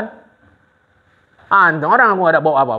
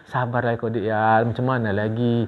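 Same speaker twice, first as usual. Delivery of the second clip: simpati pura-pura; 6 semitones lower, 6 dB quieter than usual. Retold or imitated. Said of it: "Jadi waktu dia bilang".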